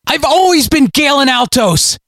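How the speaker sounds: very high desperate cry; cried out. Acted. Galen cries out his name in frantic protest. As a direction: cry it out at breaking point. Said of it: "he cried".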